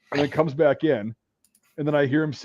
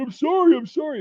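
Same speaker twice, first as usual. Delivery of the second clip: apologetic; 14 semitones higher, 4 dB louder than usual. Retold or imitated. Mocked teammate apologizing.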